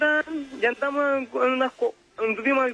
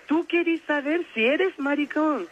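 slow, high-pitched retold question; incredulous; 6 semitones higher than usual. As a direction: spell it out in mock disbelief. Retold disbelief at the proposition. (incredulidad fingida, lenta y aguda)

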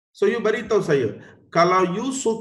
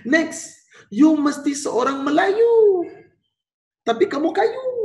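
high, slow, teasing refusal; Sultan Abu Bakar refusing; 11 semitones higher than usual. Acted. Teacher voicing Sultan turning the British away, high and slow. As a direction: talk down to the British like a sly king. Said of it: "Sultan Abu Bakar kata".

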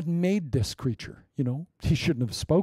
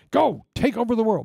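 high, eager push; rousing encouragement; 7 semitones higher than usual. Retold or imitated. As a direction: fire up your friend. Friend's encouraging voice.

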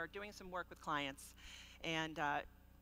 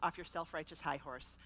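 ordinary delivery

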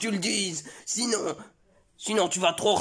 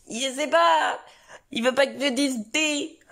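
a touch higher, puzzled little son; lightly voiced child; 9 semitones higher than usual. Son answering his mother about his father, slightly higher child voice. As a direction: innocent kid answering.

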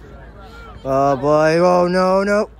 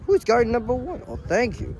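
ordinary talk

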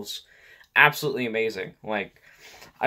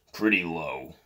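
low voice; 6 semitones lower, 4 dB quieter than usual. Shown as a demonstration. Vlogger showing how low they can go, low voice. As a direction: drop well down into a low register. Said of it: "I can go lower".

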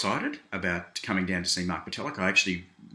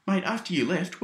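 normal talking voice

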